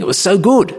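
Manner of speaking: delighted gush. Retold or imitated. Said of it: "They say".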